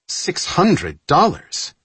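high, slow, outraged exclamation; shocked outburst. A narrator's reading. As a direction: burst out in disbelief. Outraged discovery, the same words again.

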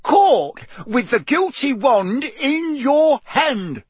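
slow, high, loud roar; roared accusation. A narrator's reading. Mister Diggory condemns the elf over the wand, roaring. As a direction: hammer each word at full voice. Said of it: "Mister Diggory roared".

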